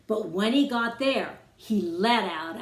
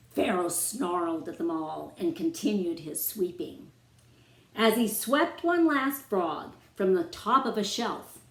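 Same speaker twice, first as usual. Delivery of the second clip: ordinary delivery, nothing marked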